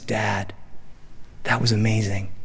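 quietly marvel, with pauses to let it land; hushed wonder. Acted. Son collapsed in dad's arms after dolphins, hushed tender wonder.

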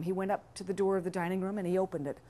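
ordinary delivery

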